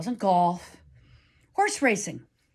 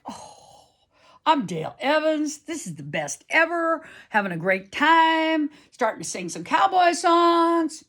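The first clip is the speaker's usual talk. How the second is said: much higher, louder, thrilled cowgirl fantasy; giddy excitement; 9 semitones higher, 4 dB louder than usual. Retold or imitated. Teen rider pretending to be [name], giddy and high. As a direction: gush like a kid living the cowboy dream. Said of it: "I'm just like".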